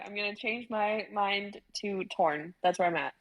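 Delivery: ordinary talk, nothing marked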